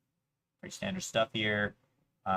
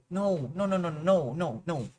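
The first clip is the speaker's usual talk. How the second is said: rising pleading no's, high and urgent; panicked no; 6 semitones higher than usual. Real, not acted. At the chess opponent's move, panicked pleading. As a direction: beg the moment away in panic.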